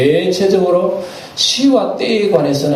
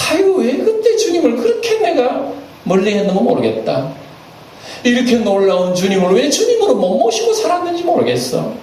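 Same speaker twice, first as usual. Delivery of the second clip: regretful laugh; 9 semitones higher than usual. Retold or imitated. Imagined regretful believer looking back.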